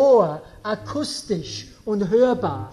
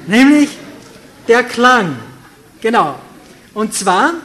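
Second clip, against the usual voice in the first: louder, emphatic preaching to the congregation; forceful preaching; 7 dB louder than usual. Acted. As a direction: press the point home like a pulpit preacher. Preacher drives home his point.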